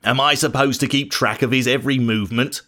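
understated irritation, brushing off the question; a hint of guilty defiance; angry retort. A narrator's reading. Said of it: "replied Cain angrily".

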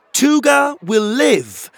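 loud, high, slow announcement; announcing. Acted. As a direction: declare it to the hall. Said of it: "Pockets announced".

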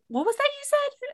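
very high mock suspicious squeak; suspicious Adam. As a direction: pitch it way up, nosy and suspicious.

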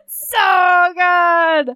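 high, loud laughing wail; laugh-cry with delight. Real, not acted. Overwhelmed laughing.

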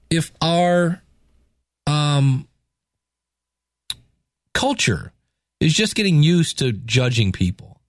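usual speaking voice

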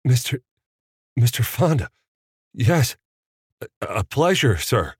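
stammering in awe; starstruck stammer. A narrator's reading. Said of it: "did his best not to blubber".

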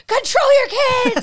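a high, loud shout, voice breaking into a cry; retold yell. Yelling at unruly kids at the carnival game. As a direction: bellow it at the running kids.

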